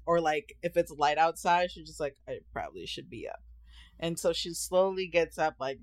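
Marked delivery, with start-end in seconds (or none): 2.3-3.4 low, soft sleepy mumble; mom waking reluctantly. Retold or imitated. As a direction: murmur it very low, half asleep.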